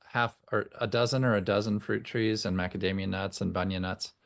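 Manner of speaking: ordinary talk, nothing marked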